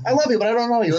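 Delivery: halting, breathy actor with a high lilt; Jeff Goldblum impression. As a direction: stammer it out like a quirky movie star. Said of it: "Jeff Goldblum impression".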